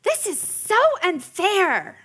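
high, slow, drawn-out whine; whiny kid. Retold or imitated. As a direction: stretch it out like a sulking child. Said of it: "she's going".

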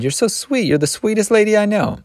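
high-pitched, sugary praise; gushing flattery. A narrator's reading. Hired help flattering Missus Turpin, gushing. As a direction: flatter her in a high coo.

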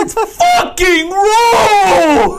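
very high, hugely drawn-out wail; mock enraged shout. Podcaster as the TV chef rejecting food, mock enraged wail. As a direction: bellow each word slowly, hammed up to breaking into laughter.

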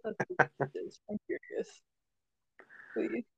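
unvoiced whispered aside; whispering. Real, not acted. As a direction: whisper it conspiratorially. Hushed aside.

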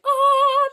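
very high, loud reenacted "oh"; elated squeal. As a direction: let out the pent-up thrill. Replays elation after teacher left.

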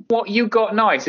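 a lighter repeat of the lady's "What you got nice"; echoing her phrase. Retold or imitated. Repeating the hospital coworker's odd phrase, half-voiced. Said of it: "is not a sentence".

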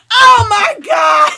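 loud, high, drawn-out shriek; real shocked outburst. Real, not acted. Wife reading husband's outrageous comment, shocked outburst. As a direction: shriek it out, helplessly amused and scandalised.

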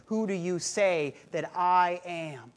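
Jesus asking Peter, slow and deliberate; unhurried probing question; slow searching question. Retold or imitated. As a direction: ask it slowly, weighing each word.

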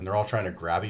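ordinary talk, nothing marked

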